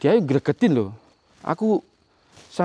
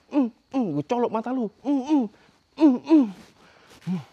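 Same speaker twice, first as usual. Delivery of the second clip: effortful high hmm grunts while hitting; high straining grunts; 9 semitones higher than usual. Real, not acted. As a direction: grunt with each blow, high-pitched. Straining grunts slapping statue. (dengusan tinggi sambil memukul)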